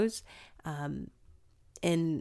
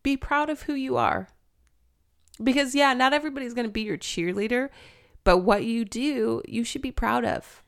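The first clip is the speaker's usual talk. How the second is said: high, choked-up encouragement; getting emotional; 6 semitones higher than usual. Real, not acted. Speaker telling her younger self to be proud, getting emotional. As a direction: urge it earnestly while welling up. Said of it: "I don't know why I'm getting emotional".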